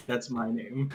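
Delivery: usual speaking voice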